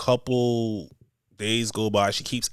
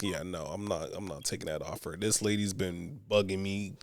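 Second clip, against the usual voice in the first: hushed, low aside to a friend; quiet refusal; 4 semitones lower, 8 dB quieter than usual. Retold or imitated. Talking privately to his friend, hushed and low. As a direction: murmur it privately, voice dropped low. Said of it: "I'm like".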